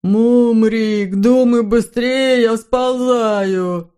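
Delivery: panicked shout. A narrator's reading. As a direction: yell for help while slipping. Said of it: "Вскоре Хемуль закричал".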